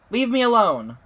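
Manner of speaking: loud, high, angry yell; angry shout. Acted. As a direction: push him away with your voice. Lila yells at pursuer.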